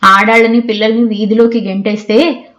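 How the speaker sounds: ordinary delivery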